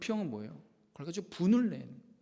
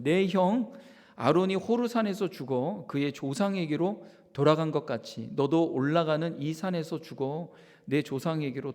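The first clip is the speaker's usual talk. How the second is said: louder, weighty reading of God's words; solemn scripture reading; 5 dB louder than usual. Acted. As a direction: proclaim God's verdict on Moses. Reads scripture as God. (하나님의 심판을 엄숙히 낭독)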